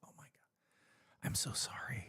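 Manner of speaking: whispered mock apology. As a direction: whisper it as the embarrassed coordinator. Retells coordinator's shamed apology.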